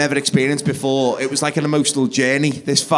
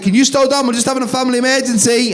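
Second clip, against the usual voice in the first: raised, high harried call; flustered calling out; 8 semitones higher, 3 dB louder than usual. Acted. Dad to whoever is knocking, flustered calling out. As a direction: call through a door, under mock pressure. Dad calls through locked toilet door.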